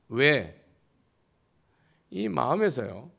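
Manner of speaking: ordinary talk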